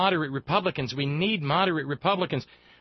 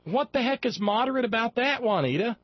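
indignant; 10 semitones higher than usual. Real, not acted. Indignant at senators.

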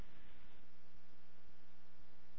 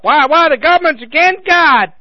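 much higher, louder, slow whine; mocking outraged voice; 10 semitones higher, 9 dB louder than usual. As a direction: mimic a riled-up believer. Mocking riled-up people.

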